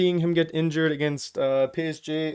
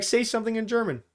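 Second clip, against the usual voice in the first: higher-pitched mimicked demand; imitated challenger; 6 semitones higher than usual. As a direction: mimic someone putting you to the test, higher. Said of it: "they'd be like".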